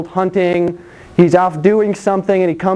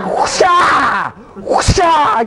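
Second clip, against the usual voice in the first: whip crack shout; 6 semitones higher, much slower than usual. Pretend. Jesus whipping temple sellers.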